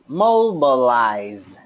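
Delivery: mobilize drawn out slowly; slow model word. Shown as a demonstration. Teacher modelling mobilize slowly. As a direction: say mobilize slowly for them to copy. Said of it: "tiếp nào".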